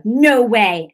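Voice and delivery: loud, forceful refusal; retold mad defiance. Woman telling the devil off on the stairs, mad defiance. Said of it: "I got mad".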